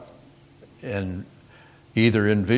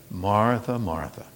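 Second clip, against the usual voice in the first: gentle; 4 dB quieter than usual. Acted. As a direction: say her name gently, like a loving teacher. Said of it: "Jesus said gently".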